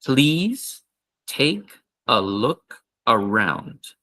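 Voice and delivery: slow invitation, please lifted; slow repeat. Shown as a demonstration. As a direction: drill it slowly again. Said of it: "one more time".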